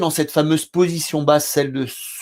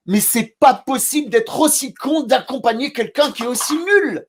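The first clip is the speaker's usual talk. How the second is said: much higher, exasperated self-scolding outburst; getting carried away; 8 semitones higher than usual. Retold or imitated. Therapist berating himself in front of his client, high exasperated outburst. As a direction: blow up at yourself in mock disbelief, pitched way up. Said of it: "je m'emporte, un peu comme je le fais là".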